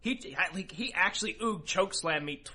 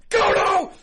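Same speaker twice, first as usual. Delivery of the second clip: full enraged yell of the name, much higher and louder; 14 dB louder than usual. Retold or imitated. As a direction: scream his name in rage. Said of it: "So I just freak out".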